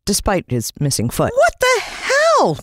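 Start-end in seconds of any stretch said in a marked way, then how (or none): 1.3-2.6 high, slow, terrified scream. A narrator's reading. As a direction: shriek at the sight of the zombie. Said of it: "screamed Jalan".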